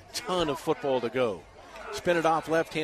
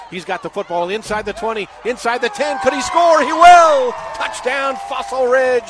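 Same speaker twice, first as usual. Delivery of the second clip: climbs from lively to a screaming, much higher and louder peak; building to touchdown shout; 9 semitones higher, 13 dB louder than usual. Real, not acted. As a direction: ride the run up to an all-out touchdown yell. Touchdown run called live.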